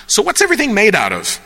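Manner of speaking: high, quick, eager question; naive questioner. Acted. Layman asking quantum physics people, high eager question. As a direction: ask it fast and high, like a keen amateur. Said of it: "and you're like".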